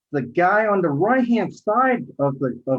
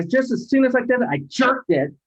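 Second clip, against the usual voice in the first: normal talking voice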